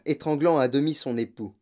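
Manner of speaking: plain reading voice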